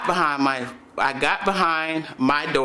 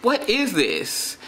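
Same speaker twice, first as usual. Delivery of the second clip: higher, slow, amazed; a hushed what-is-happening disbelief; stunned wonder; 7 semitones higher, much slower than usual. Retold or imitated. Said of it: "I was just like".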